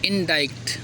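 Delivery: "इंडाइक्ट" with the k sounded; as spelled. Shown as a demonstration. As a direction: sound a k before the final t of "इंडाइक्ट". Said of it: "इंडिक्ट लिखते हैं".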